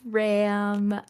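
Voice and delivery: usual speaking voice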